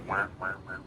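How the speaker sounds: low, soft muffled wah wah; muffled underwater voices. Retold or imitated. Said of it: "you hear people talking outside the water".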